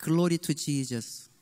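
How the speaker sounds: lightly voiced son, slow and low; drawn out like a solemn blessing. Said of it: "우리 아들 항상 하는 말".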